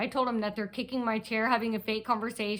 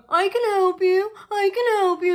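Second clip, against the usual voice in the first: high, loud put-on voice; imitating a man's offer; 9 semitones higher, 6 dB louder than usual. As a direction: mimic him, pitched way up and loud. Mimics the man beside her.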